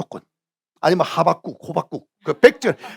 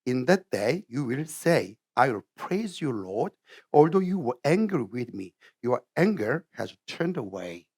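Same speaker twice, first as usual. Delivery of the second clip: very slow, careful English reading; softer, deliberate pronunciation demo; slow and precise; 4 semitones lower, 8 dB quieter, much slower than usual. Shown as a demonstration. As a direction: spell out each word slowly, stresses marked. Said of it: "천천히 해야 돼. 정확한 발음으로".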